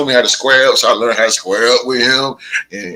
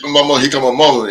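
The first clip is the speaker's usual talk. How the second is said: ordinary delivery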